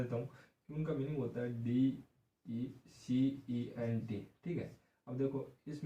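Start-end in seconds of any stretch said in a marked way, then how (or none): 1.6-4.3 spelled out slowly; slow, separated letters for learners. Shown as a demonstration. Teacher spells out the word. (एक-एक अक्षर धीरे-धीरे)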